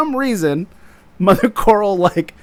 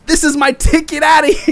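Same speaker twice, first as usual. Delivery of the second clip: laughing through a high quoted line; giggly mock line; laughing quote; 10 semitones higher, 5 dB louder than usual. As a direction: quote him while cracking up. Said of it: "and goes".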